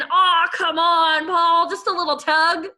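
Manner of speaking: pleading pigtail, drawn out; urging. A narrator's reading. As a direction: wheedle him into one little tug. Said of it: "urged the pigtail".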